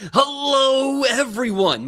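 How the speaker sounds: high, loud, drawn-out hello; soaring, stretched announcer greeting; correct showy greeting. Shown as a demonstration. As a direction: belt out a big host's welcome. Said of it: "Let me do this correctly".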